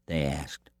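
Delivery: ordinary delivery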